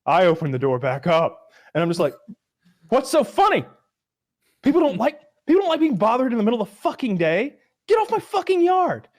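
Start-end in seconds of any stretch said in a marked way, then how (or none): 2.9-9.1 high, loud angry outburst; furious, retold. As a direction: tell the salesman off, voice climbing. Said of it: "like, an eight out of ten furious".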